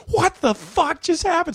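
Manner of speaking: baffled onlookers; stunned disbelief. Retold or imitated. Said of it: "the guys next to me are like".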